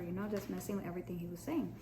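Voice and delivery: ordinary talk, nothing marked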